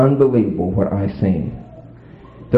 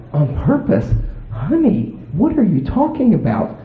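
playing dumb; 10 semitones higher than usual. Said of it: "I just acted like".

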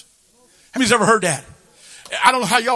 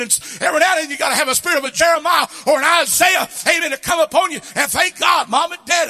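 high, loud shouted sermon; full-force preaching; 7 semitones higher, 3 dB louder than usual. Acted. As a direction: thunder the rebuke at the parents. Preaching climax.

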